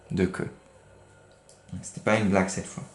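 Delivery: ordinary delivery, nothing marked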